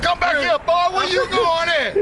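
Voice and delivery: high-pitched retold holler; calling after him. Sibling hollering after fleeing brother, retold call. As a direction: call after a runaway boy, stretching the end.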